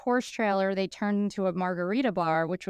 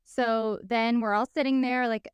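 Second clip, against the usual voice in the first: ordinary delivery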